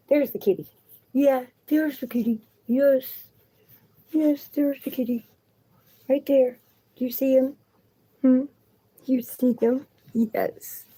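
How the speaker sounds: high sing-song pet talk. Real, not acted. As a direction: coo excitedly to the dog. Showing pet the kitty.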